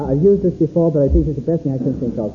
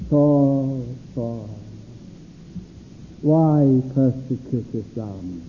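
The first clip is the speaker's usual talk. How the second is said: a lower, slow, gentle plea; lower, slow tender call; 6 semitones lower, slower than usual. Retold or imitated. Preacher voices God calling Saul, lower and slow.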